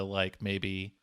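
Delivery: usual speaking voice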